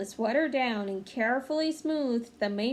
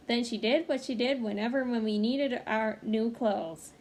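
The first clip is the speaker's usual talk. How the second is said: usual speaking voice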